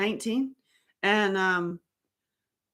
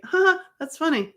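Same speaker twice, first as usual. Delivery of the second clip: fake laugh; 10 semitones higher than usual. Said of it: "I was like".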